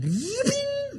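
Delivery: very high, playful squeal; high-pitched squeal. Acted. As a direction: let out a mock-amazed squeal. Acting out amazed reaction to miracle.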